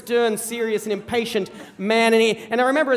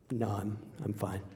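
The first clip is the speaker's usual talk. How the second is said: quiet refusal; 11 semitones lower, 16 dB quieter than usual. Retold or imitated. Son declining hunting, mumbled.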